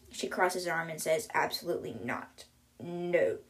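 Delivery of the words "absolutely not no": low, quiet, firm no; firm refusal. Retold or imitated. Avery refuses the boy's teasing nickname, firm and low. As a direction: refuse it in a lowered voice.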